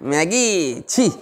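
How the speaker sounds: slow, drawn-out mock disgust at a runny nose; disgusted exclamation. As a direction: pull a face and groan it out. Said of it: "মাথায় টালাক না টালাক বলবে". (ঘেন্নায় টেনে টেনে বলা)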